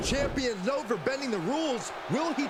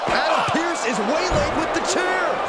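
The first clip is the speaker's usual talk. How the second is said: louder urgent calls; loud commentary; 6 dB louder than usual. Acted. Commentators reacting to a chair attack, loud. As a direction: shout the action as it breaks out.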